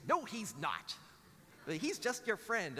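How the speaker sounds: put-on high-pitched child contradicting dad; dismissive daughter. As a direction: pipe up like an unimpressed kid.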